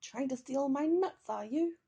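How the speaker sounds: angry squirrel. A narrator's reading. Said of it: "angry chittering noise".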